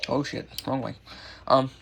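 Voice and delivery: ordinary delivery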